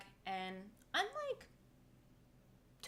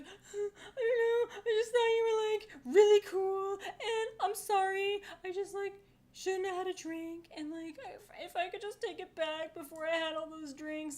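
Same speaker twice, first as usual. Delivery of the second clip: mock sobbing; 12 semitones higher, 6 dB louder than usual. Teller imitates the crying driver's apology, high mock sobbing. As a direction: whine it out like a crying drunk man. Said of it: "And he's like".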